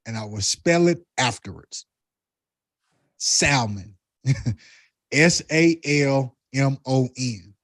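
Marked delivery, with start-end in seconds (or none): 3.2-3.9 Salmon with the L clearly sounded; Salmon said deliberately, L not silent; own pronunciation. Real, not acted. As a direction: say Salmon as "SAL-mun", voicing the L. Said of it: "I don't pronounce it like everyone else".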